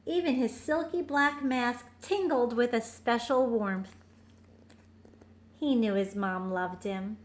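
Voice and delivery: put-on gentle read-aloud for a child; storybook narration. A narrator's reading. Parent reading a bedtime picture book, gentle.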